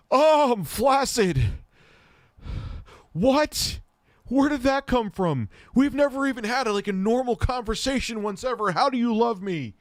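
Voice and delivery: loud, very high mock disbelief; aghast outburst. Man reacting to a hookup's sudden love confession, comically aghast. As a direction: cry out in hammed-up shock. Retold shock at love declaration.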